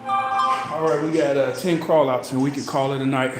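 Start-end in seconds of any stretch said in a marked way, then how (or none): none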